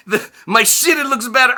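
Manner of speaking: put-on "my shit, it looks better" repeated in a laughing Eastern European accent; Roman's accent again. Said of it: "I lose my shit laughing".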